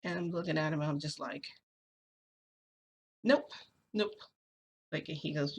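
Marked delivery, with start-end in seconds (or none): 3.2-4.1 high, loud, abrupt refusal; acted-out no, pitched way up and punched; defiant refusal. Teller recounting her answer to him in an argument, defiant.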